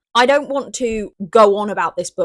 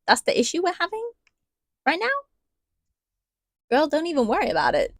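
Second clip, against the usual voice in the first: 1 mock unimpressed; 9 semitones higher, faster than usual. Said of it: "it just felt a little bit like".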